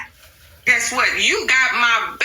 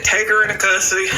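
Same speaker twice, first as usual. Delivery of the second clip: quick judge's order; faster than usual. Retold or imitated. Judge to the court officers, fast and sharp order. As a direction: snap the order out.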